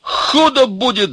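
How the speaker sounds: high, loud, drawn-out cry; shouted threat. A narrator's reading. Ivan threatening the laughing secretary, shouting. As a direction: yell a warning. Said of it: "закричал Иван".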